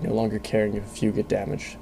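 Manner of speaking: ordinary delivery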